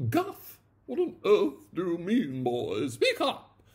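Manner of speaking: high, loud imperious demand; commanding aunt. A narrator's reading. Aunt ordering her nephew to speak, high and loud. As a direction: bark at the boy to speak up. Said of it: "commanded his aunt".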